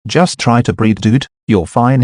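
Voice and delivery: put-on worried young man checking on someone. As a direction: ask anxiously if he's hurt. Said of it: "he said worriedly".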